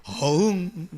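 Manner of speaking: hammed-up lion roar; second lion roar try. Shown as a demonstration. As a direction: growl it out like a lion again. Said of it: "비슷한가?".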